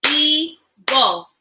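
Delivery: "Eagle" in two drawn-out parts with a gap; syllable split. Shown as a demonstration. Teacher breaking a word into parts, syllable split. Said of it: "Break words into parts with me".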